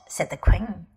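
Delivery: usual speaking voice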